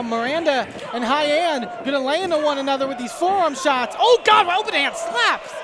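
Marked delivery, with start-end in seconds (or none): 4.0-5.5 high, loud shocked outburst; excited shout. Real, not acted. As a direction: yell as the slap lands. Reacting to slaps in ring.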